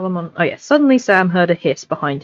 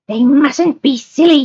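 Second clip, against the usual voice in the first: high, slow, louder hissing creature voice; hissing Gollum; 8 semitones higher, 4 dB louder, slower than usual. Acted. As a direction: hiss it out like Gollum. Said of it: "he hissed".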